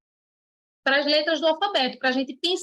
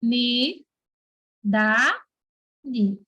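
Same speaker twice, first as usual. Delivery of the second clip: "Ni, da, di" with a pause after each syllable; 3 semitones lower, much slower than usual. Shown as a demonstration. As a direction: dictate "Ni", "da", "di" separately. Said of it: "É assim que se separa essa palavra".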